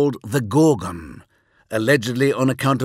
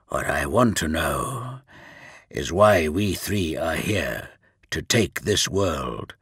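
grim death lord voice; 6 semitones lower than usual. Acted. Mortarion speaks in character.

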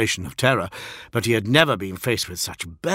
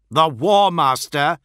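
louder, higher, slow correction; firm interjection; 7 semitones higher, 7 dB louder, slower than usual. A narrator's reading. Fulgrim corrects the envoy's slip, firm and loud. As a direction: cut in firmly, stretching each word. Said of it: "put in Fulgrim".

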